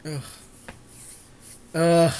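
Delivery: ordinary talk, nothing marked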